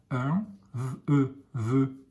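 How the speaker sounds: put-on slow letter-by-letter blending for a child; sounding out letter by letter. Shown as a demonstration. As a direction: sound out each letter of sœur, then blend into sœur. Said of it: "teacher sounds out letters".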